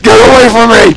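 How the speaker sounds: high, loud panicked shrieking; scared horror game streamer. Real, not acted. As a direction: scream it in terror. Monster attack.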